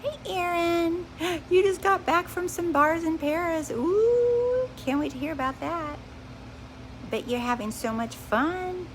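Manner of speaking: put-on high chirpy fan-girl hello; gushing excited greeting. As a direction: squeal a delighted hello to a friend back from Paris. Greets a viewer by name.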